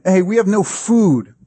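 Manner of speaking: high mock protest; complaining. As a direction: imitate a grumbling crowd calling out. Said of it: "They complain".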